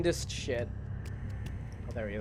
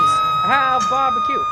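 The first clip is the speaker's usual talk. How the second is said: slow, squeaky falsetto, much higher and louder; mock falsetto taunt; 8 dB louder, slower than usual. Killer streamer teasing a survivor about his perk in slow falsetto. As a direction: drawl it high and teasing.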